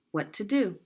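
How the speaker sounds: ordinary talk, nothing marked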